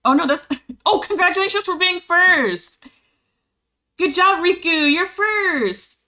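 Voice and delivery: high, loud, squealing congratulations; excited high-pitched cheer for viewers. Real, not acted. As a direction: gush over the winners. Celebrating first-place viewers.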